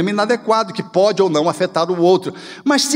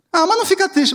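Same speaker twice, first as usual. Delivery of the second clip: put-on much higher, pleading child's voice; child comforting; 10 semitones higher, faster than usual. Child begging mother not to be sad, high pleading. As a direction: plead up high like a little kid consoling mum.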